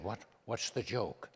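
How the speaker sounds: imitating Reagan, low and soft; hushed, folksy Reagan impression; Ronald Reagan. Reagan confiding to the comedian about his impressions, low and soft. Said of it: "He said".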